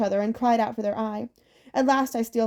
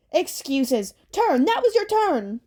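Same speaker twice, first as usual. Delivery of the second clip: nagging hag; 7 semitones higher, 3 dB louder, slower than usual. Acted. Hag nags the driver.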